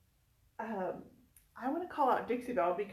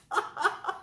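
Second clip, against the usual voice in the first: slow, higher teary laugh-cry; weeping with laughter over the gift; 5 semitones higher, much slower than usual. Real, not acted. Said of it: "am crying right now".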